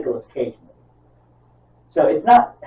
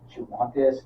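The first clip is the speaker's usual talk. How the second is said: retold coaxing; 8 dB quieter than usual. Voices cult leader coaxing the wife.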